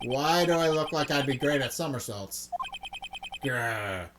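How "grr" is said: a low growl from the throat; low tiger growl. Acted. The circus tiger stalking the lawyer, low growl. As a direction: growl like a big cat.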